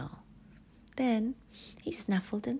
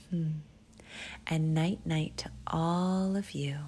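plain reading voice

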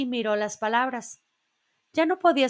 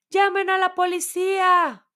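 higher, louder cry for help; alarmed shout; 9 semitones higher, 5 dB louder than usual. A narrator's reading. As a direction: shout for the police in panic. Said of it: "gritó alguien". (grito alarmado)

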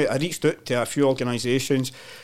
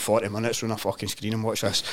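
ordinary talk, nothing marked

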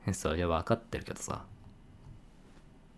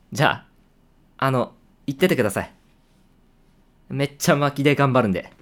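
higher, louder, quick eager send-off; hurried upbeat excuse; 6 semitones higher, 8 dB louder, faster than usual. Acted. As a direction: rush them out the door cheerfully. 先輩たちに慌てて送り出す. (先輩に明るく早口で言い訳して送り出す)

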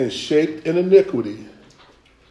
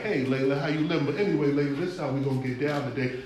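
softer, casual, sly devil small talk; smooth devil; 9 dB quieter, faster than usual. Acted. Devil casually greeting Layla, softer and sly. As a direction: greet her like a friendly devil who means harm. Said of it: "And the devil is like".

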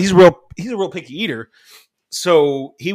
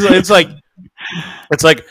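ordinary delivery

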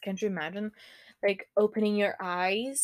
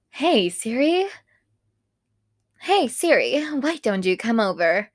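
mock Siri voice; 2 semitones higher, 6 dB louder than usual. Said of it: "Oh my God, I sound stupid".

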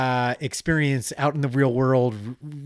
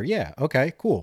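ordinary delivery

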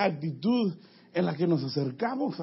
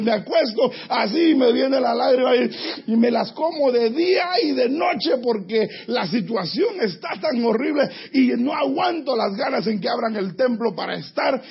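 louder, pressing preaching lament; fervent preaching; 4 semitones higher, 4 dB louder than usual. Acted. Preacher voicing the psalmist's despair to the congregation, fervent and loud. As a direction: drive each line harder.

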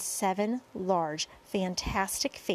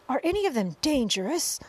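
higher, mildly raised anxious shout; shouted question; 5 semitones higher, 4 dB louder than usual. A narrator's reading. Police chief asking about the creatures, mildly shouting. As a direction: call the question up. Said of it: "shouted the chief of police".

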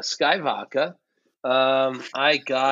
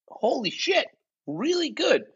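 higher, excited raving; 12 semitones higher than usual. Real, not acted. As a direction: gush about the flavor. Raving about tangerine flavor.

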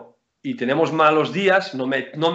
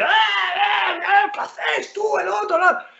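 mock yelling coach; slower than usual. Player imitating a typical coach yelling at the team. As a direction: ham up a coach screaming from the bench. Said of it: "Porque normalmente la gente".

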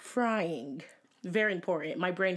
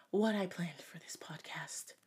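whispering; 6 dB quieter than usual. Real, not acted. Confiding aside to listeners.

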